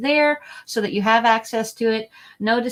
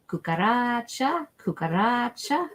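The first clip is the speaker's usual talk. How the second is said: slow sing-song; 3 semitones higher, slower than usual. Shown as a demonstration. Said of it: "there's a song with it".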